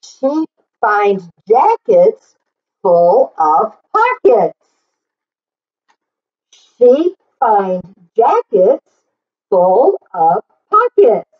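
rhythmic read-aloud, leaning on each sheep; reading to children. Acted. Teacher reading the book aloud to the class, in a lively sing-song. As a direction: read it out like a picture book for little kids.

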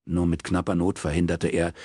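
ordinary delivery, nothing marked

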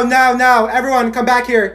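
ordinary talk

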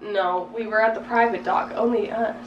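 normal talking voice

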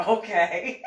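high breathy laughing "okay". Real, not acted. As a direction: dissolve into laughter. Laughing while answering.

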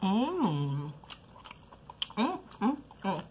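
humming while chewing food; closed-mouth chewing sounds; mouth full, chewing. Real, not acted. Seen 1: Bites chicken at -4, then chews with closed, full mouth throughout.

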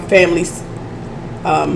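ordinary talk

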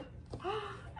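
sharp high gasp of delight. Real, not acted. Opening a wine box and spotting a postcard, gasp. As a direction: catch your breath at a surprise.

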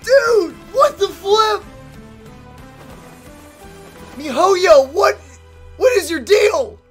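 high, loud, incredulous shouting; amazed outburst. Real, not acted. Host addresses game maker MiHoYo over trailer, amazed outburst. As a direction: yell in delighted mock outrage.

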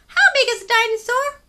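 high, loud curious little penguin voice; a tiny penguin full of questions. Acted. Story reader voicing small penguin Pipkin asking big questions. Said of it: "said Pipkin".

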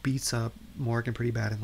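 ordinary talk, nothing marked

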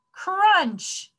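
high, falling crunch; dramatic crunch. A narrator's reading. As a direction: pounce on the word from up high. Dramatic biting sound word in story.

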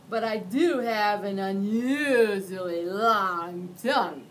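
loud, slow slobbery monster voice; slurping monster. Acted. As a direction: talk through slobber. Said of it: "Mac slurped".

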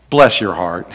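low, syrupy pity; mock sympathy. Shown as a demonstration. Preacher demonstrates a condescending Southern phrase. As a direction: pat them on the head with it. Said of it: "this next phrase".

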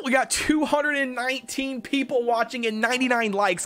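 ordinary delivery

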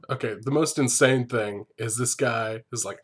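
ordinary delivery

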